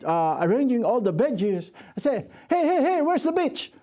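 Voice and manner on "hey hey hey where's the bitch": put-on angry yell at store boy. Angry father yelling at the store boy. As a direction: snap at the boy to find the beach. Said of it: "I'm, I'm angry".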